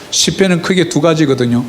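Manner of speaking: ordinary delivery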